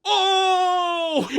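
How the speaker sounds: high, drawn-out "ohhh"; high, long mock-awed "oh"; geeky crowd "oh". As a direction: imitate a fan reacting to a legendary burn. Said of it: "and geeks like me".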